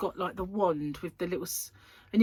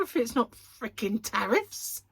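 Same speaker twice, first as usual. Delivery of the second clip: usual speaking voice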